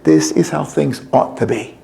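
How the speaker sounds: slow, soft and low, drawn out; low contented close; tender. A narrator's reading. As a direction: savour each word with quiet pride. God contented, closing the story.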